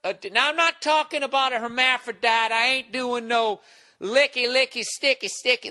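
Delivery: put-on high drunken rambling, stretched words; mocked drunk guy. Drunk guy playing his crude game, mocking imitation. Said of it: "He was playing that game".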